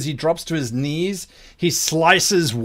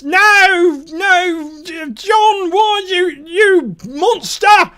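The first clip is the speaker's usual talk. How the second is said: very high, loud wail; anguished shriek; 14 semitones higher, 6 dB louder, much slower than usual. Acted. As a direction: shriek in horror at your friend's killer. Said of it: "and shrieks".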